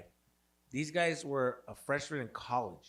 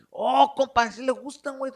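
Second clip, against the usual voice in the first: high, loud paisa outburst; mock the cocky paisa, pitched way up; paisa kid; 12 semitones higher, 10 dB louder than usual. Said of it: "paisa kids, they're fucking, they're".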